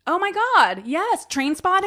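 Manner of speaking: loud, high burst of nostalgia; excited recognition. Real, not acted. Cohost recalling Blur and Trainspotting, loud and thrilled. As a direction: exclaim it, thrilled to remember. Remembering Blur, Britpop era.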